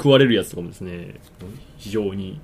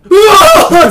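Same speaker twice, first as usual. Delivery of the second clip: loud comic scream of fright; re-enacted yell of terror; startled scream; 13 dB louder than usual. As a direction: scream as if grabbed in the dark. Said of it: "大声出してるから".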